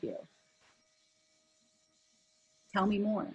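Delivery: empathetic listening. Shown as a demonstration. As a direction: coax the other person to open up, gently. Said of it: "And I'll just say".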